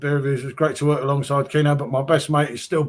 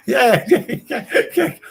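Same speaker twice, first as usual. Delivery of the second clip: laughing yeah; 9 semitones higher than usual. Real, not acted. Laughing at Barry's drunk comment.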